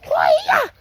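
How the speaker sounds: very high, loud martial-arts cry; kung fu shout. Shown as a demonstration. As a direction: yell it like a karate kick. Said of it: "Kung fu".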